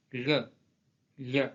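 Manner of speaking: "le" as a palatal ly; Portuguese-like lh on "le"; LH sound. Shown as a demonstration. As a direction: demonstrate "le" twice as separate sounds. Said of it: "et les lettres L H".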